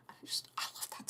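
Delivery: breathy whispered love. Real, not acted. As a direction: hush it out, moved. Emotional whisper about the line.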